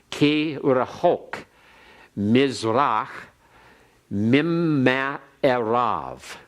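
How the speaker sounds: slow, careful Hebrew, each word set apart; slow teacher's Hebrew recitation; Hebrew read aloud. Shown as a demonstration. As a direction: model the Hebrew for students to copy. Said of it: "I'll read that one more time".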